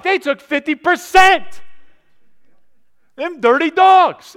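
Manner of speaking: high, loud, slow hammed-up indignation; mock outrage at taxes. Preacher mocking his own tax rage, high and loud. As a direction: play up the fury over taxes. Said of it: "and you're like".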